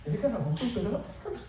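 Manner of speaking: high, soft, coy falsetto. Retold or imitated. Teen looking back at the doctor, coy falsetto. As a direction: ask it sweetly like a tease. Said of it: "I said".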